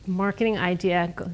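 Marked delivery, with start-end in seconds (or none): none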